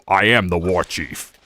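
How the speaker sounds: a slow, low hint of the orc war chief; Thrall voice. Streamer voicing Thrall for chat, slow and low. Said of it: "Thrall from Warcraft".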